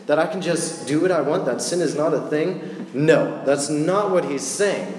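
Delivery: ordinary talk, nothing marked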